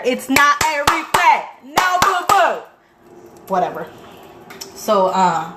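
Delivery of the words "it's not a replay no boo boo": louder, higher, drawn-out mock scolding; exasperated retort. Telling off people who dismiss the movie, exasperated. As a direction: build to a high, loud telling-off. Retold retort, escalated second take.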